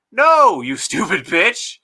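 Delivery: loud, high, drawn-out scolding; exasperated retort. Acted. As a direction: drag out the insult in exasperation. Acted retort to those readers.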